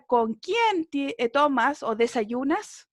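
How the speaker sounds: slow, deliberate Spanish rendering; slow translation. Shown as a demonstration. Teacher giving the Spanish version of the question, slow. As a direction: translate it slowly for the class. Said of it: "Y en español sería".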